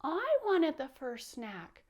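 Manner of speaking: high, loud, slow mock whine; pouting, crying. Teacher voicing Powder getting snack last, upset pouting whine. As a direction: wail it upset, dragging the words. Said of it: "He would pout, he would cry".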